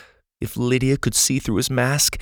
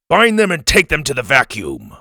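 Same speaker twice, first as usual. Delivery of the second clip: loud commanding bark; barked order; 5 semitones higher, 5 dB louder than usual. Acted. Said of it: "one of the guards barked".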